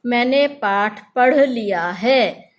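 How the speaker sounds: slow, deliberate example sentences; slow demo sentence. Shown as a demonstration. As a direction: say पढ़ slowly so the flap is clear. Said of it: "इसका उच्चारण इसकी अपेक्षा थोड़ा हल्का होता है". (धीमे, सोच-समझकर उदाहरण वाक्य)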